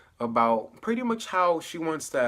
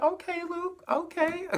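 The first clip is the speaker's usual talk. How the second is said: much higher, sing-song okay to a character; mock approval. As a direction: cheer him on in a high teasing voice. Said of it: "And I was like".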